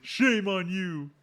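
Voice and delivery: high, slow echo of a telling-off; hammed-up, stretched shaming; mock scolding. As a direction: drag it out in playful reproach. Host echoes the parody scolding.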